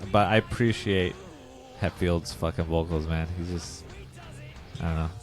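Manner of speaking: ordinary delivery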